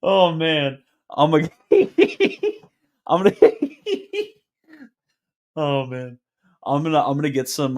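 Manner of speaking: talking through helpless giggles; cracking up. Real, not acted. Streamer losing it at his own Hennessy joke, cracking up. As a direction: keep breaking into high laughter mid-sentence.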